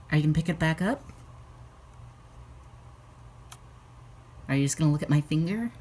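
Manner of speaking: chatty pet-owner voice; pet talk. Real, not acted. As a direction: coax the pet along, quickly. Coaxing pet to take food.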